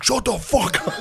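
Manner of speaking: high-pitched mock outburst; spazzing out. Roommate snaps from dying laughing, high retold outburst. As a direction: imitate a friend suddenly snapping, voice jumping way up. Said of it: "just spazzed on me".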